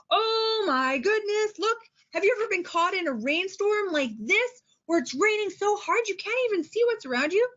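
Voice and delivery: high-pitched, wide-eyed storytime amazement; amazed teacher. Shown as a demonstration. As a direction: marvel at the picture for young children. Demonstrating amazed picture talk to kids.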